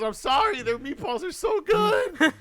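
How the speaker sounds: high, slow, loud mock-mom plea; high mock-mother whine. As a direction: imitate mom pleading guiltily. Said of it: "And she goes".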